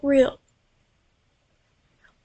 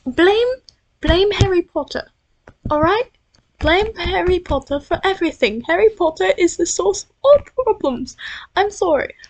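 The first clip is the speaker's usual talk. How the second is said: loud, high-pitched outburst; mock rant; 5 semitones higher, 5 dB louder than usual. Real, not acted. Vlogger blaming Harry Potter for everything, in a loud mock rant. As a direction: burst out in exasperated, over-the-top blame.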